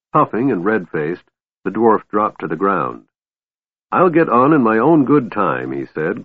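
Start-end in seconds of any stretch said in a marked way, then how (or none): 3.9-5.7 raised, moderately indignant retort; glaring, indignant. A narrator's reading. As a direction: insist stubbornly, pitched up. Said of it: "glaring at the Kender".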